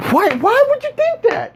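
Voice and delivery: high-pitched exasperated disbelief; incredulous. Acted. Reacting to an adult's silly question, incredulous. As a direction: demand it in high, baffled disbelief. Mock outrage at absurd question.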